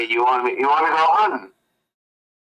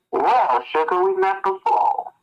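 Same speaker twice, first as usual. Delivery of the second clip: sweet southern belle; 8 semitones higher, slower than usual. Acted. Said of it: "She goes".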